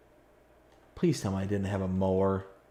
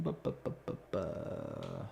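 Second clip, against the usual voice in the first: bu sounds sliding very low and soft; dropping stall sounds; 10 semitones lower, 13 dB quieter than usual. Real, not acted. Gamer stalling while browsing mowers, voice sinking low. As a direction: let the stammer sink into a murmur.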